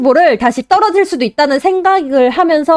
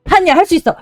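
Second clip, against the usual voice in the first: ordinary talk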